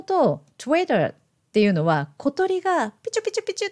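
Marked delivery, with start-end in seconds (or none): 2.9-3.7 quick little birdsong imitation; chirping bird. Shown as a demonstration. Teacher explaining where the name comes from, imitating chirps. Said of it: "さえずる音から来てる".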